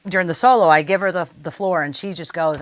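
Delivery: ordinary talk, nothing marked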